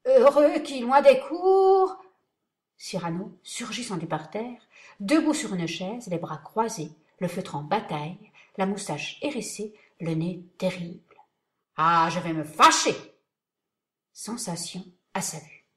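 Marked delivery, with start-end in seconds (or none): 0.1-2.0 high, strangled, slow; choking. Acted. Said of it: "Montfleury s'étranglant". 11.8-12.9 angry. Acted. Cyrano threatens angrily.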